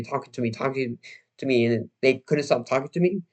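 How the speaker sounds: ordinary talk, nothing marked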